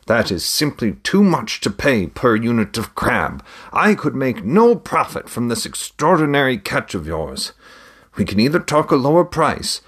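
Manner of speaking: father voice. A narrator's reading. Father haggling with the trader.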